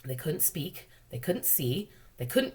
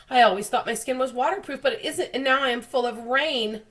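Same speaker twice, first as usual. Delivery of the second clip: ordinary delivery, nothing marked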